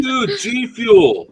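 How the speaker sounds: high, loud drawn-out shout-out; excited. Real, not acted. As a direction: hype up the sponsor.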